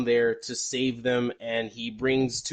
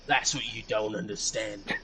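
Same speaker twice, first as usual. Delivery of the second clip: lightly mimicked grave action-hero line; dramatic movie hero. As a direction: deliver it like a mock-serious movie hero. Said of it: "interrupts the meeting very dramatically".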